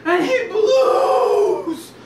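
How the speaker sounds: loud, high, very stretched word; huge climactic shout. A narrator's reading. Storyteller at the wolf's big blow, shouted long. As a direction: bellow it and draw out the verb.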